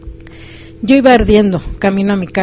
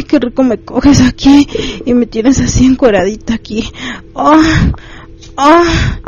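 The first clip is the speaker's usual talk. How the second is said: higher-pitched moaning cries, not a full shout; acted cries of pleasure; 4 semitones higher than usual. A narrator's reading. Narrator voicing the woman in his story, crying out in pleasure. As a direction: cry out in pleasure, voice lifted high. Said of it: "mientras gritaba".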